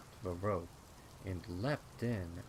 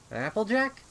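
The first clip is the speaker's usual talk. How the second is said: puzzled call; 13 semitones higher, 13 dB louder than usual. Acted. Sister speaks from behind, puzzled.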